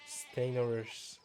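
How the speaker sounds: "Steinerers" sounded out slowly, syllable by syllable; a halting, slowed-down guess at "Steinerers"; unsure pronunciation. Shown as a demonstration. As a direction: feel your way through "Steinerers" slowly. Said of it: "refrain myself from pronouncing words I don't know".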